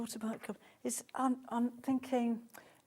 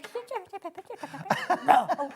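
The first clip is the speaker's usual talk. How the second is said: breaking voice noises; 9 semitones higher than usual. Pretend. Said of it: "Cubley going through puberty".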